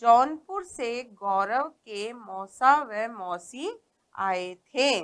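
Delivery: slow, word by word, au sounds clear; slow careful reading. Shown as a demonstration. Teacher reading story sentence to class, slow and clear. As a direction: read it slowly for the children. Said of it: "तो हम पढ़ते हैं अब".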